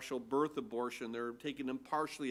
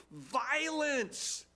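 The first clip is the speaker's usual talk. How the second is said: shrieked cry; 10 semitones higher than usual. Retold or imitated. Prophet crying out to God, mildly shrieked high. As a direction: cry 'Violence!' on a sudden high pitch, like a mild mock shriek. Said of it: "It's literally a shrieking".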